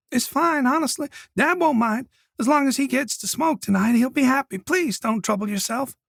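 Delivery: put-on high, polite young girl; timid girl, eager to please; shy girl voice. A narrator's reading. Colette voiced by narrator.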